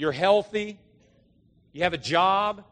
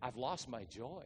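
much softer, slow, near tears; quiet teary retelling; almost in tears; 5 semitones lower, 17 dB quieter than usual. Retold or imitated. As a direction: barely voice it as if holding back tears. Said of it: "almost in tears".

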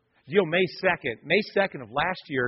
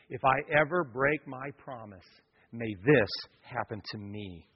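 much softer, lower, intimate pulpit voice; tender quiet sermon passage; hushed preaching; 5 semitones lower, 5 dB quieter than usual. Acted. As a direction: drop almost to a murmur to draw them in. Hushed vow and cross passage.

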